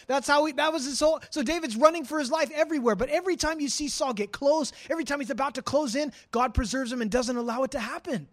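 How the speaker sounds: usual speaking voice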